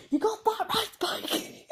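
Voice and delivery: high, loud shrieking scream; a scream that cracks into laughter; real scream. Retold or imitated. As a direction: shriek it out at the top of your voice. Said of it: "that's not really a scream".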